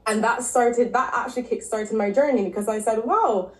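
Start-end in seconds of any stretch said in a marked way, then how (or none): none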